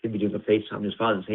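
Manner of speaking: usual speaking voice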